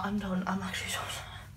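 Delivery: exhausted whisper; tired whisper. Real, not acted. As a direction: whisper it, worn out. Exhausted giving up.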